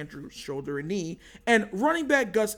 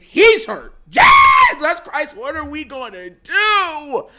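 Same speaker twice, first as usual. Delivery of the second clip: panicked outburst; 7 semitones higher, 10 dB louder, slower than usual. Real, not acted. Panic over injured receiver.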